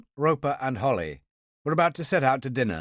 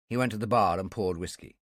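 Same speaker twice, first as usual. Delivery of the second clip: ordinary delivery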